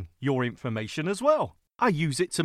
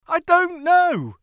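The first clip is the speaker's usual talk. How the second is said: exasperated; 11 semitones higher, 8 dB louder, slower than usual. Acted. Own reply in acted quarrel.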